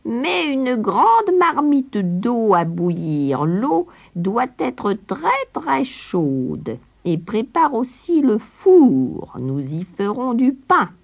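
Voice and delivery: very slow, high crone orders; commanding witch. A narrator's reading. Witch ordering Gretel to heat the pot, slow high crone. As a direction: drag out the commands with relish. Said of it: "Elle appela aussitôt Gretel".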